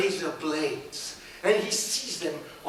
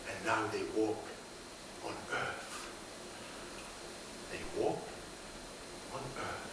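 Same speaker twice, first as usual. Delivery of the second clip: soft closing; 12 semitones lower, 14 dB quieter than usual. A narrator's reading. Quiet story ending.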